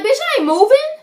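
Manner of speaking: high, loud, fast telling-off; re-enacting telling off a bank clerk, high and fast; angry retort. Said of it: "I'm like".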